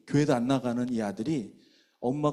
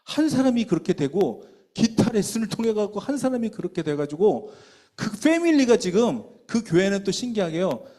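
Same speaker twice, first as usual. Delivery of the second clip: building fervor; 6 semitones higher, 5 dB louder than usual. Real, not acted. Preacher builds to climax.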